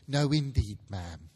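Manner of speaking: lightly voiced, eager to please; a hint of appeasing charm; ingratiating woman. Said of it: "whose manner was remarkable for general propitiation".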